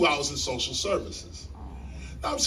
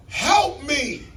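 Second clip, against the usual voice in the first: loud, higher plea for help; pleading cry; 9 semitones higher, 5 dB louder than usual. Retold or imitated. Homeless man begging the universe, loud high plea. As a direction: call out for help to something bigger. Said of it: "But you say to something".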